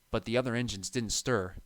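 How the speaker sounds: plain reading voice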